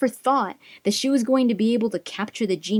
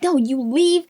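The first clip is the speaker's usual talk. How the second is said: higher-pitched mock-indignant order; raised voice for a retold dismissal; retold firm refusal; 7 semitones higher, 3 dB louder than usual. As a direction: act out sending someone away sharply. Retold argument, ordering him out.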